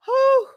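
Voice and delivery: high excited whoop. Real, not acted. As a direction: let out a happy cheer. Cheering a viewer's arrival.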